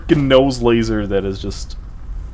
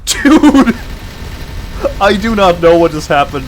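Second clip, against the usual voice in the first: loud, fast, high disbelief; excited shout; 7 semitones higher, 6 dB louder than usual. Real, not acted. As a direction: blurt it out in shock. Shocked outburst at the loss.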